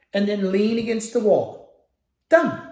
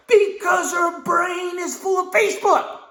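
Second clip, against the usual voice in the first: exasperated shout; 7 semitones higher than usual. Real, not acted. Ranting about distracted assistant.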